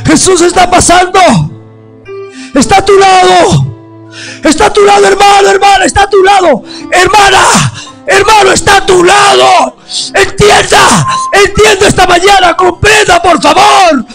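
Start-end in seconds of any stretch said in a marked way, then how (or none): none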